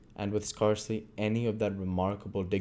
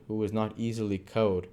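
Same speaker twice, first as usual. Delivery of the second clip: ordinary delivery, nothing marked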